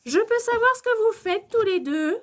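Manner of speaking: high, loud, indignant questioning; maîtresse sévère. A narrator's reading. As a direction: demand an explanation from two pupils. Teacher catches two pupils fooling around. (maîtresse qui interpelle, voix haut perchée)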